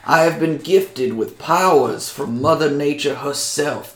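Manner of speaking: put-on unhurried, proud old nature druid in character; old druid helper.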